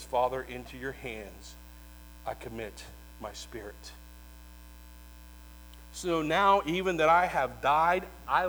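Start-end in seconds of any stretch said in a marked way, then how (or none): 0.0-3.9 commit the spirit gently as life ends; dying last words. Acted. Preacher voicing Jesus' final statement from the cross, faint.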